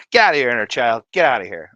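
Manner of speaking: loud playful shooing; hammed-up dismissal; mock scolding. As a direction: chase it off with a pretend yell. Mock shooing his inner child.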